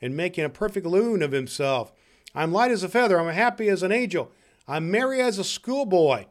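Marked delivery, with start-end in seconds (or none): 2.3-6.3 high, louder exclamations; giddy joy. Acted. Scrooge wakes happy as an angel, exclaiming giddily. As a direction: gush each line with delight.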